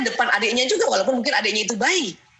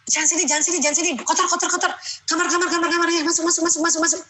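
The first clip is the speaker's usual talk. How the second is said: higher, rapid repeated shooing words; a busy mother brushing her child off, mock impatient; shooing nag; 5 semitones higher, faster than usual. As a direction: chase the child off to its room, impatient.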